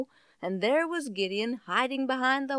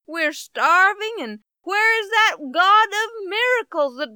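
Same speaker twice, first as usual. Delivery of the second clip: higher and louder, pleading and indignant; anguished protest; 6 semitones higher, 5 dB louder than usual. Acted. Gideon protesting to the angel, loud anguished pleading. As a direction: cry out the complaint in disbelief.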